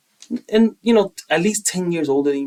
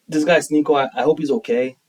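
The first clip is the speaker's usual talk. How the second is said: normal talking voice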